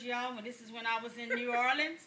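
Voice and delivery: normal talking voice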